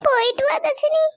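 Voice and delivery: high, choked-up goodbye; tearful farewell. Acted. Parent sending a daughter off to marriage, crying. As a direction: voice breaking with tears as she lets her go. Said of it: "நீங்க ரெண்டு பேரும் அழுதீங்கன்னா".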